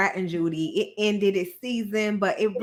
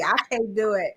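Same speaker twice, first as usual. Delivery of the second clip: ordinary delivery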